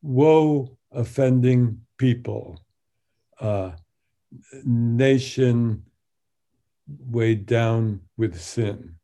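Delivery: slow, weighty reading with pauses; measured recitation. Shown as a demonstration. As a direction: lay each phrase down heavily. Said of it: "I translated".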